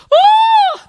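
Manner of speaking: imitated very high, loud operatic whoop; hammed-up operatic shriek of a man being tased. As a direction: belt a mock opera finale note as if being tased. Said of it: "He does literally something like that".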